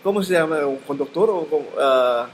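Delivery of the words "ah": normal talking voice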